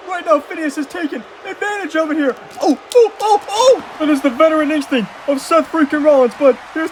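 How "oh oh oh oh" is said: high, loud burst of ohs; startled commentary. Acted. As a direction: react live to a sudden big move. Commentator reacts to wrestling move.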